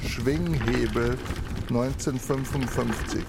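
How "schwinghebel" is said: ordinary delivery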